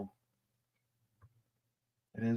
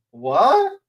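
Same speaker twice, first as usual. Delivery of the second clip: incredulous; 8 semitones higher, 6 dB louder than usual. Real, not acted. Outraged disbelief.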